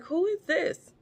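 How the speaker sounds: high-pitched, baffled question; puzzled father. Retold or imitated. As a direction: retell his confusion with pitch jumping up. Blind father startled by second son.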